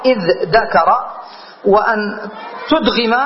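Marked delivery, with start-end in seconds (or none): 0.0-1.0 "إذ ذكر" as idh-dakara, dh and d kept apart; both sounds distinct. Shown as a demonstration. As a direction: say "إذ ذكر" without merging dh into d. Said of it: "على الإظهار".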